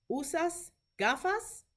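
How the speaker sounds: slow demo question, lifting the pitch on "gafas?"; rising question tone. Shown as a demonstration. Teacher modelling a question for clinicians, "gafas?" rising at the end. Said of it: "how my voice goes up at the end".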